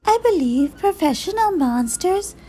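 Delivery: high, preening monster, drawing out the end; fussy, high-pitched monster; high silky monster. A narrator's reading. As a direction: pronounce it primly in a high silky voice. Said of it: "in a high, silky voice".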